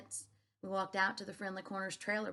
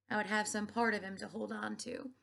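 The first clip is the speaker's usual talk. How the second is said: ordinary delivery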